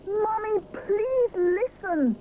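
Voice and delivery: high, slow, urgent plea; understated desperate shout. Acted. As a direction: beg to be heard. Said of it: "shouts Rupert desperately".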